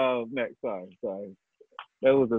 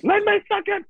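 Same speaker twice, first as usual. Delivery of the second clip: much higher squeaky come-on; high mock voice; 4 dB louder than usual. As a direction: go way up and beg playfully. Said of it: "I had too much fun with that".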